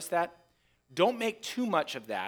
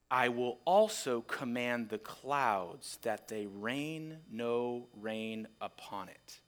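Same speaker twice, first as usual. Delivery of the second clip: softer, lower and slow; quiet and slow; 5 semitones lower, 6 dB quieter, slower than usual. Shown as a demonstration. The owner reads his final threat over the vineyard, quiet and slow. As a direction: let the threat sink in quietly. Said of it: "until that last line".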